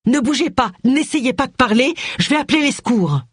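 anxious, high-voiced rescuer; urgent alarm. A narrator's reading. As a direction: keep an injured man still, urgently. Urgent reassurance to wounded man.

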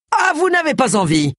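high-pitched outraged exclamation; indignant retort. A narrator's reading. Inspector answering the naked man's refusal, indignant. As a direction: throw the words back indignantly.

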